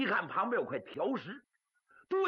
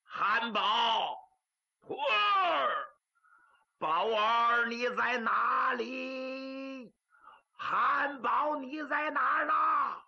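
long, drawn-out loud calls, high and slow; monk calling out; 5 semitones higher, 3 dB louder, much slower than usual. Acted. Monk searching rooms shouts for his disciple, slow and loud. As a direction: bellow for the lost disciple across the yard. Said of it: "这冷然就喊开了".

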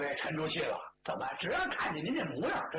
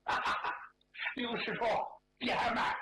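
mock laugh; 6 semitones higher than usual. Zhu grabs Liu, mock delight.

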